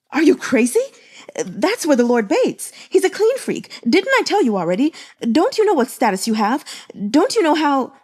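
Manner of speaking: high, loud, rushed telling-off; frantic scolding. A narrator's reading. Servant berates Gumang for bathing in the Lord's spring, frantic and loud. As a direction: berate him in a panic.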